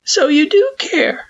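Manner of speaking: high, hurting but hopeful voice; a weak, hurt question; pained Frisk. Acted. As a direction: squeeze it out through the pain. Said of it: "A pained voice".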